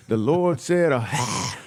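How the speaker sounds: hammed-up mad preacher, high-pitched, phlegmy gasp at the end; mock church preacher straining out words; yelling preacher. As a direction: preach it with a hacking "ah". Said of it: "The dude on stage yelling, he mad, he got phlegm".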